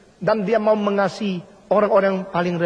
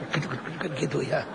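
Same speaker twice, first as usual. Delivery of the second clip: mock stiff spring bows; 5 semitones lower, 8 dB quieter, much faster than usual. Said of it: "kepalsuan seperti dipasang pir-pir".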